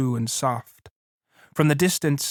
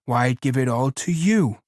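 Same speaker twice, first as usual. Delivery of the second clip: plain reading voice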